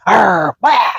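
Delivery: mock growl and bark; imitated dog lunge. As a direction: imitate a dog suddenly snapping at someone. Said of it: "he, like, lunges at them".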